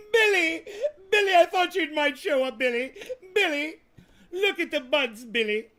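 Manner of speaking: high, loud sung-out calls of Billy; calling for Billy. Pretend. Summoning Billy over the buds with a loud, high call.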